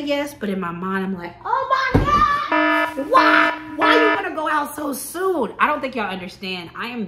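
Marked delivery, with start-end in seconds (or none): 1.4-5.5 high, loud freak-out; mock-panicked squeal, stretched out; panicked inner scream. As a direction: shriek it in utter disbelief, much higher. Inner panic at being asked out.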